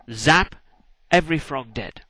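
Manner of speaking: usual speaking voice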